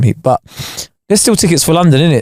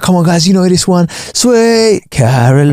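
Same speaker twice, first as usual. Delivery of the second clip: hype host voice; 6 semitones higher, slower than usual. Retold or imitated. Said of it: "he's gonna be there like".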